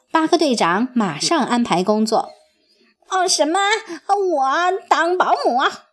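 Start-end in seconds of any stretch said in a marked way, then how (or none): 3.1-5.9 incredulous. A narrator's reading. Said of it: "呱唧感到难以置信".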